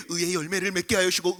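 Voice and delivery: low monotone prayer, imitated; older boys praying. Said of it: "옆에서 형들이". (낮게 읊조리는 형들의 기도 흉내)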